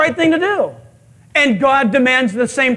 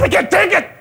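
high, hammed-up outburst; exasperated Moses; 7 semitones higher than usual. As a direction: plead with God at the end of your rope. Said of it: "he goes and he talks to God".

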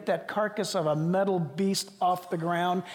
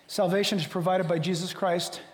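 ordinary talk, nothing marked

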